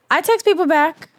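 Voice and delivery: indignant. Real, not acted. Defending herself, repeated.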